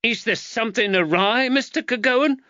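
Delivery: high, loud angry wife; a shrill-free but high, cross mother; angry woman. Acted. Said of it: "said the angry woman".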